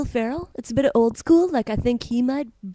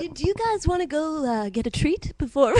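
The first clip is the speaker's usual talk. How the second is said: put-on higher, friendly Stittsville accent; eager suburban Ottawa accent; upbeat Stittsville accent; 4 semitones higher than usual. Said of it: "The Stittsville accent".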